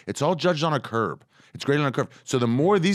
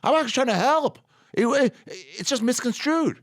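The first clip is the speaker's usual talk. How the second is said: mock innocent; 14 semitones higher, 3 dB louder than usual.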